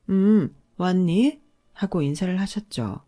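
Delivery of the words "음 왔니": slow, unbothered mother-in-law greeting; drawn out, calm hello. A narrator's reading. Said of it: "평온하게". (평온하게 느릿한 인사)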